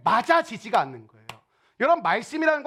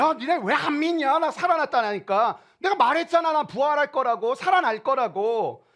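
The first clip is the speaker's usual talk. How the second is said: higher, exasperated scolding; Jesus, riled up; 5 semitones higher than usual. Retold or imitated. Risen Jesus scolding disciples for not believing, riled up. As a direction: imitate an indignant telling-off, stretching the ends. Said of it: "예수님이 막 열받아가지고 나타나신 거예요".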